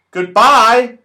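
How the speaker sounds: loud, high call from above. Acted. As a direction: call goodbye from far up. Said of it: "called Moonface from the top of the tree".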